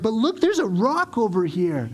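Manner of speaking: put-on high, excited little boy's voice; dawdling child. Young son to his hurrying dad in the parking lot, high and distracted.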